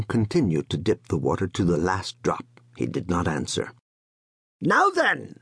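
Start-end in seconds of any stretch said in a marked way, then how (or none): 4.6-5.4 put-on high, sharp cry of an old father; cried loudly. A narrator's reading. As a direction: call out to stop the son. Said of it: "cried his father loudly".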